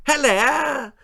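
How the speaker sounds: very high, loud foghorn screech; snooty lady's piercing hello; screeching posh lady. A narrator's reading. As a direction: shriek the greeting like a grand lady. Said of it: "screeched Lady Davinia".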